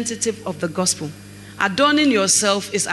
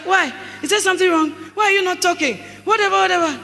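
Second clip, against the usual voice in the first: higher, insistent demands to talk; higher mock nagging wife; 6 semitones higher, faster than usual. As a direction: push him to speak.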